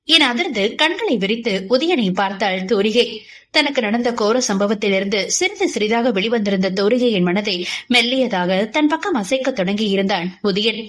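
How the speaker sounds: usual speaking voice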